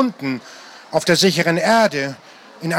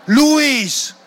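high, urgent cry of the name; a higher panicked "Luis!"; alarmed call; 8 semitones higher, 3 dB louder than usual. A narrator's reading. Pilot dead, calls him.

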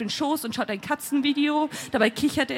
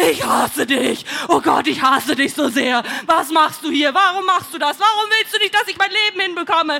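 loud rant climbing in pitch; furious outburst; 2 semitones higher, 5 dB louder than usual. A narrator's reading. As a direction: hurl accusations, building to a desperate peak. Yells at him in a quarrel. (wütender, lauter Ausbruch)

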